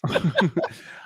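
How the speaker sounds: short burst of laughter. Real, not acted. Cohost breaks off mid-point about a rogue fan, laughing. Laughs after losing his thought.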